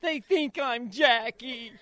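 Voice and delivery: slow, high, whiny son voice; mock whine. Son complaining to dad that people mistake him for his sister, slow high whine. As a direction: act out a sulking kid.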